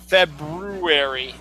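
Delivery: February slow with long BROO; correct February. Shown as a demonstration. Host correcting, slow Feb-BROO-ery for February. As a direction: stretch the R syllable of February. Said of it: "but it is".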